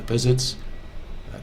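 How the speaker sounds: normal talking voice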